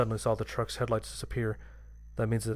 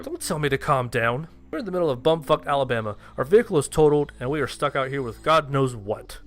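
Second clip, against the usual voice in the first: angry outburst; 4 semitones higher, 6 dB louder than usual. Acted. Narrator snaps during argument.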